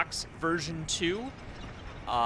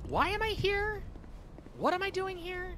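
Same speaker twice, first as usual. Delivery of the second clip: high confused voice; 4 dB quieter than usual. Acted. Confused character voice.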